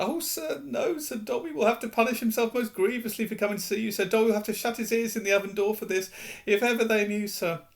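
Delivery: very high, fast, fearful elf voice; horrified at the thought of punishment; frightened house elf. Acted. Dobby shudders, fearful.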